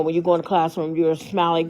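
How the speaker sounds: usual speaking voice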